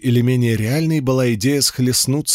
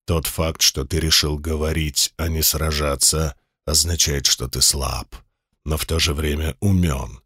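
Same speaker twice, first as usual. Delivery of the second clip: insinuating sinister voice; 6 semitones lower than usual. Acted. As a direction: purr it low like a sinister giant demon. Demon boss voice held.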